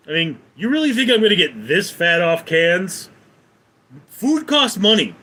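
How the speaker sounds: high, loud indignant scolding. Real, not acted. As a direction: tell the rude chatter off. Retorting to insulting chatter.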